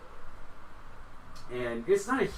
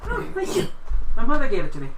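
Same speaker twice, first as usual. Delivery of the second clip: high-pitched, pleased librarian voice; flattered librarian; 11 semitones higher, 10 dB louder, faster than usual. Acted. Librarian thanking a visitor for praising her necklace, flattered. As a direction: accept a compliment in a high character voice.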